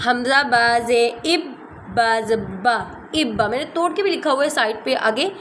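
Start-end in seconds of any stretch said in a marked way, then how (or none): 0.1-2.8 "حمزہ با زے اب با زبا" spelled out slowly, syllable by syllable; slow spelling out. Shown as a demonstration. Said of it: "جیسے کہ ہم پڑھتے ہیں".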